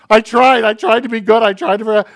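mock exasperated complaint. Preacher voicing a believer who failed, frustrated outcry.

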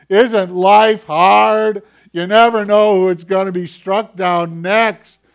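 high, loud, slow whiny wail; mock wailing. Preacher mocking Israelites wailing at their tent doors, high whiny wail. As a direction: ham up the public wailing of complainers. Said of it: "They weren't privately wailing".

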